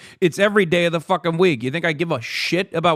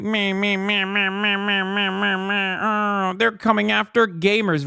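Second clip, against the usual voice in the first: high, droning mock bawl; mock crying; 4 semitones higher than usual. Streamer imitating gamers who constantly cry, mock crying. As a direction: imitate a crybaby, higher and drawn out. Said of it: "Constantly crying like".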